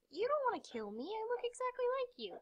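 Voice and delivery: high little coaxing voice; mock pleading. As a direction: plead softly, sliding up very high. Pleading with look-alike player.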